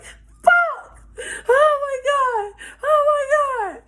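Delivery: loud high squeals imitating her panic; hammed-up panicking girl; mock freak-out. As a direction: shriek each one way up high. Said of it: "She really freaking the fuck out".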